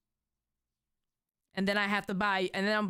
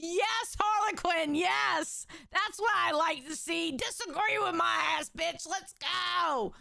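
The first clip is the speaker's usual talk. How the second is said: excited cheering; 9 semitones higher than usual. Real, not acted. Cheering on a chat comment.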